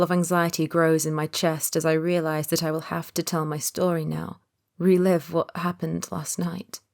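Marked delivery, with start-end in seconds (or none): none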